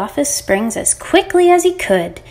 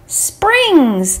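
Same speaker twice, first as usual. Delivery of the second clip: higher, drawn-out word after a suspenseful wait; excited reveal; 6 semitones higher, 3 dB louder than usual. A narrator's reading. Narrator reveals what the lamb found, excited. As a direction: land the surprise with a big lift.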